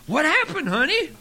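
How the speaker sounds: lightly acted concern, pitched well up; concerned wife. Wife greets her exhausted husband at the door, mock concern. Voicing the worried wife in joke.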